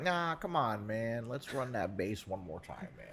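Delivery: easygoing friend, only lightly put on; Scott impression. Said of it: "That's my Scott impression usually".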